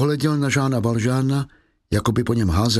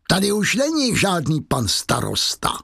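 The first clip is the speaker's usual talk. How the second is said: gloating; 6 semitones higher than usual. Acted. Javert gloating after laugh.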